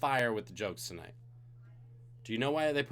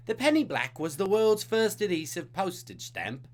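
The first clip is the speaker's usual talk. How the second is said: trivia question read in a put-on British accent, pitched up and louder; 7 semitones higher, 5 dB louder than usual. Host reading the stamp question in a hammed-up British accent. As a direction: play the British quizmaster. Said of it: "me British accent".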